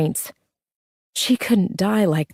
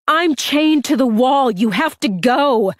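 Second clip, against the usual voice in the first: urgent shout; 7 semitones higher than usual. Acted. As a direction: push her away with your voice. Urges sister to leave.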